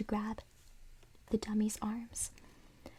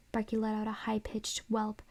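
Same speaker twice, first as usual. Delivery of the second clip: ordinary delivery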